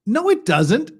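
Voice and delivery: high, loud flat no; sharp rebuttal. Acted. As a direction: shoot the claim down hard.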